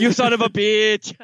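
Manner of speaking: high, hammed-up Austrian-accented movie line; botched Austrian accent. Co-host echoing a favourite movie line, shaky Austrian accent attempt. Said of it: "I can't do the accent".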